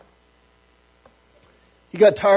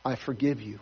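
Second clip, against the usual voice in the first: quiet forgiving; 7 semitones lower, 10 dB quieter than usual. Pretend. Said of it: "the other believer will say".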